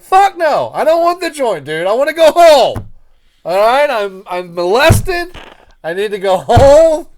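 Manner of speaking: loud, high, fed-up shouting; retold yelling. Yelling at Keith that he wants to go home, loud and high.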